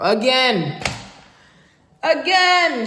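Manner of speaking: excited shout. Real, not acted. Celebrating day off.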